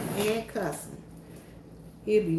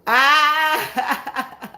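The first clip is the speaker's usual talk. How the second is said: big loud burst of laughter; 5 semitones higher, 5 dB louder than usual. Real, not acted. Caught giving the kids candy, loud burst of laughter. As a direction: crack up out loud.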